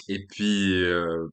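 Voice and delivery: ordinary talk, nothing marked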